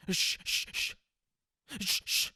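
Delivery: breathy shh-shh to shoo a cat away; shushing a cat. Retold or imitated. Mimicking how people shush an unwanted cat, breathy shushes. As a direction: hiss out short shushes like shooing a stray. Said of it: "what do you do usually?".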